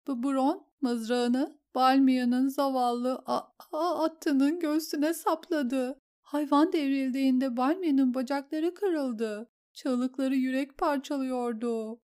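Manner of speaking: a shaken noblewoman reliving the horror; distraught lady. Acted. Lady tells the queen how the duel went wrong, tearful.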